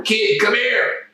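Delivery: high, slow put-on voice of Ted calling a kid over; old ballplayer beckoning a teen; gruff calling. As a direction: drawl it out like a big tough veteran. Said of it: "who calls me over".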